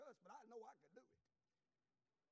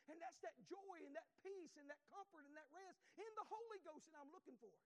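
preaching pitched up high; pitched-up revival preaching; rising preaching; 4 semitones higher than usual. Acted. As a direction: climb into the sermon's fervour. Preaching builds to full force.

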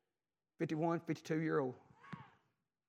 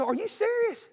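high-pitched imitation of stunned disbelief; incredulous; 4 dB louder than usual. Preacher voicing wife shocked at news of a death, incredulous. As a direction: ask it in shocked, sharply raised pitch.

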